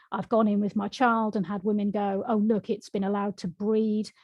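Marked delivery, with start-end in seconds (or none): none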